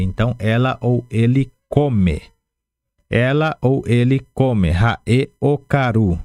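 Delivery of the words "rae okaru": "Rae okaru" slowly and clearly, then "Okaru" again; teacher spelling out "okaru" with the voice; exact pronunciation demo. Shown as a demonstration. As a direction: model "okaru" slowly for repetition. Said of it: "A pronúncia é exatamente assim".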